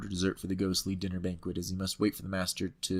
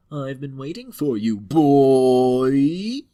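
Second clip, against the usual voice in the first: lightly voiced creepy mortician, boy punched loud; a hint of a sinister tall stranger; 4 semitones higher, 12 dB louder, much slower than usual. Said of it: "He smiles in a creepy voice and says".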